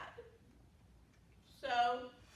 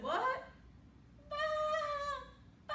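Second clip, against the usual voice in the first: sheep bleat. A narrator's reading. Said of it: "sheep said".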